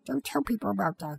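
hushed. Acted. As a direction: hush him like a mortified kid. Kid embarrassed, hushing dad.

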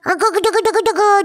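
rapid "gu-gu-gu" computer babble; computing noises. Acted. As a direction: stutter out machine thinking sounds. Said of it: "that is the sound of computations in his brain".

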